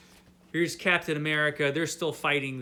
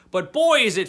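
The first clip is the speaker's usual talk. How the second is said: delighted; 5 semitones higher, 9 dB louder than usual. Real, not acted. Telling viewers how fun the series is, excited outburst. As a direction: burst of real enthusiasm.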